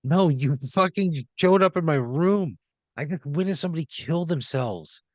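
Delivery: indignant outburst. Acted. Player rebuking intruders in the bedroom, indignant and exasperated. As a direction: protest hotly at the intrusion.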